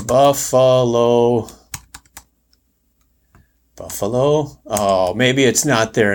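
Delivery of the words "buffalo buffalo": slow, drawn-out spelling check. Shown as a demonstration. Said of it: "I probably didn't spell it right".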